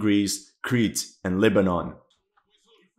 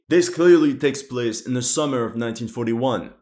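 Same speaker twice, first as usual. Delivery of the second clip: ordinary talk, nothing marked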